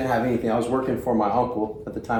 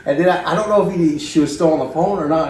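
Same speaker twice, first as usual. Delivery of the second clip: ordinary talk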